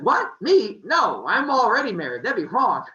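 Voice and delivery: high, loud, startled 'What? Me?' as Zeus; mock shocked refusal. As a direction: act flustered like a god caught off guard, loud and high. Zeus dodging a marriage offer.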